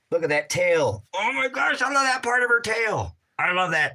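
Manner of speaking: high squealing shout over the tail; excited squeal. Real, not acted. Owner gushing over his cat's tail, squealing high. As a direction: squeal with delight.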